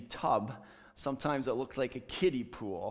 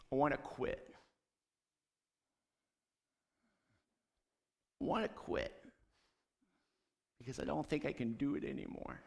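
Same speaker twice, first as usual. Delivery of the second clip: quiet, broken plea to Jesus; retold prayer of someone close to giving up, hushed; 5 dB quieter, faster than usual. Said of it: "I was really broken".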